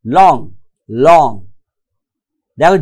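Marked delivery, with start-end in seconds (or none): 0.1-1.3 long said as a single separate beat, twice; each long in one mouth movement, pause between; one-syllable demonstration. Shown as a demonstration. Teacher showing one mouth movement, long as one beat. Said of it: "Movement of my mouth".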